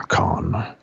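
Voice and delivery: very low breathy whisper. Retold or imitated. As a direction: breathe it out, deep and unvoiced. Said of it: "whispered Khan".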